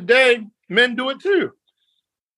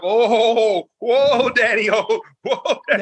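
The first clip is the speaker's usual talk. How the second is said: mock bedroom moan; 3 semitones higher, slower than usual. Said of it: "pushing him out the first couple times".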